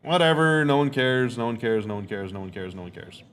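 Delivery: dismissive fading. Real, not acted. Dismissing a teammate's shot.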